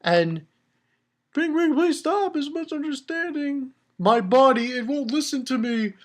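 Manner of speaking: high, tearful pleading; crying plea. Retold or imitated. A crying king begging his soldiers to stop, tearful high plea. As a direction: sob out the plea, voice pushed up high. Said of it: "he's just crying".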